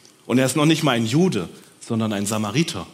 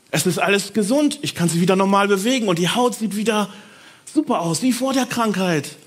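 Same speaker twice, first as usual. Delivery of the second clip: high, loud excited exclamation; can't believe his own healed body; amazed joy; 8 semitones higher, 3 dB louder than usual. Acted. Healed leper shows the others his skin, excited and high.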